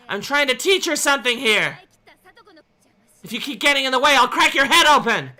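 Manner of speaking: high, loud furious threat; threatening shout. A narrator's reading. Angry girl threatening Rika. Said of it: "target of my anger".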